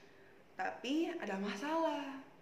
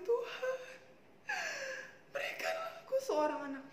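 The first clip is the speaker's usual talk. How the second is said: acted sobbing plea, pitched up at first; tearful prayer; 12 semitones higher, 3 dB quieter, faster than usual. Hana begs God for a child, mock crying. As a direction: beg through put-on sobs, catching the breath. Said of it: "Ibu Hana menangis".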